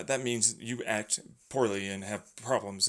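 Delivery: ordinary delivery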